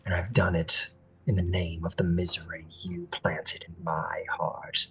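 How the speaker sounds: low, measured monologue sinking toward a whisper; bitter, anguished fae prince. Acted. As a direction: confess low and deliberate, breath taking over.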